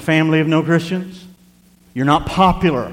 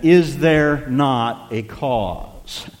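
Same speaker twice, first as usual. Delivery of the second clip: drawn out and falling; slow emphatic question; much slower than usual. Shown as a demonstration. Preacher pressing the verse's question, slow. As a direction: let the question sink slowly. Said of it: "In the original Hebrew, it's deeper".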